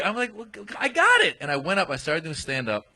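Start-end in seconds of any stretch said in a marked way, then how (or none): 0.4-1.4 high, stumbling mock confidence; rattled reassurance. Retelling his rattled reply to supporters.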